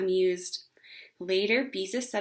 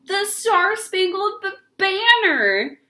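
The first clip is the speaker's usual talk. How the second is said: much higher, louder, words broken by laughing; gasping laughter; 11 semitones higher, 8 dB louder, slower than usual. Acted. Beezus to the family, gasping with laughter. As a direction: choke the words out between laughs. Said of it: "she gasped".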